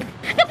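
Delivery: sob. Acted. Laugh cry heard alone.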